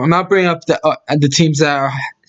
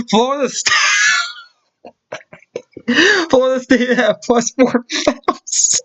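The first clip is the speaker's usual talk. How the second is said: laughing fit; 8 semitones higher, slower than usual. Real, not acted. Keeps cracking up at the odds.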